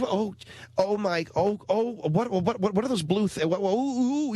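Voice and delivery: put-on high fussy old-lady fretting; fussy grandmother. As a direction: fret over strange food like a grandmother. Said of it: "He's like a grandmother".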